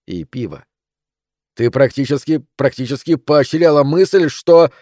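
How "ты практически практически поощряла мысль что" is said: loud voice climbing higher; heated accusation. A narrator's reading. Husband accusing his wife, heated and rising. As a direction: build the accusation until it breaks off.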